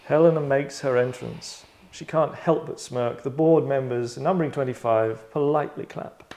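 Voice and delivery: slow, measured narration of the scene; game master narration. A narrator's reading. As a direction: narrate the entrance, slowly.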